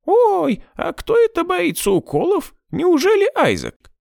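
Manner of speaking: squeaky high puppet cat voice; thin high voice. A narrator's reading. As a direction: play a teasing toy cat. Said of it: "заговорил тонким высоким голосом".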